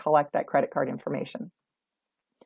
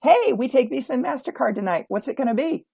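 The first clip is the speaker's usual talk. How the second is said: assertive greeter; 6 semitones higher than usual. Shown as a demonstration. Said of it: "They say".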